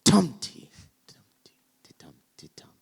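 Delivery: loud high Tum T, then whispered ti-tum; broken trochaic beat. Shown as a demonstration. Showing a shifted verse rhythm, punched then whispered. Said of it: "Then he might go".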